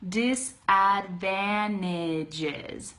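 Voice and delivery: "disadvantages" very slowly as "disadvannages"; slow-motion "disadvantages" with N for NT; dropped T, slow. Shown as a demonstration. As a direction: sound out "disadvantages" by syllable, no T. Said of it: "Say it like that".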